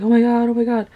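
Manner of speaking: hammed-up panic, repeated in alarm; mock freaking out. Cat pushed into the flowing river, acted freaking out. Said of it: "freaking out".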